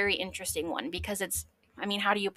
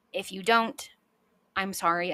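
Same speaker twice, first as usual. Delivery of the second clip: ordinary delivery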